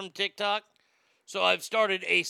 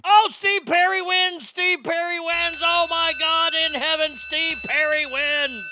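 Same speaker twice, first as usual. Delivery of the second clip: high, loud excited outburst; excited shout; 7 semitones higher, 4 dB louder than usual. Real, not acted. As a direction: shout the result in disbelief. Shocked result announcement.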